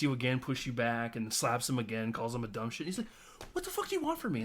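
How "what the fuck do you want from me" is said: very high, strained exasperated yelp; mock exasperated squeal. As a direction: squeak it out like a cornered boyfriend. Voicing exasperated boyfriend.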